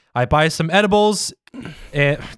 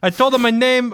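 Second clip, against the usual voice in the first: high, lilting botched Bostonian; Boston accent turning Irish; 8 semitones higher than usual. Acted. Reader tells of calling the loan company, wobbly Irish-Boston accent. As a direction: let a bad Boston accent slide into Irish. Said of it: "A true Bostonian".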